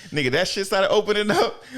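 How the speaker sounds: normal talking voice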